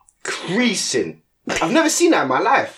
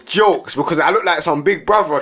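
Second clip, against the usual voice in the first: ordinary talk, nothing marked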